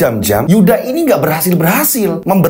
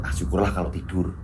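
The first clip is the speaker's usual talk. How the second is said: quiet aside; 11 semitones lower, 13 dB quieter than usual. Acted. Relieved aside to himself.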